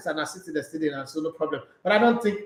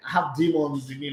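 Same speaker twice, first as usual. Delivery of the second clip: normal talking voice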